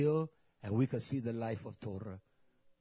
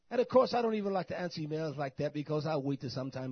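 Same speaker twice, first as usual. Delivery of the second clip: ordinary delivery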